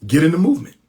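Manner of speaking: rallying call. Real, not acted. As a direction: push it out to the crowd. Rallying listeners to join movement.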